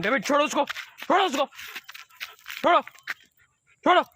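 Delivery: firm voice so the dog drops it. Shown as a demonstration. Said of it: "थोड़ा सा ज़ोर से आवाज़ में बोला".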